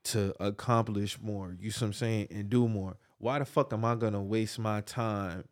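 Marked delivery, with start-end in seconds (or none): none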